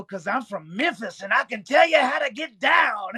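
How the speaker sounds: put-on Memphis blues storyteller voice; Memphis blues singer. Shown as a demonstration. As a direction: play an old-school Southern blues singer, building to a holler.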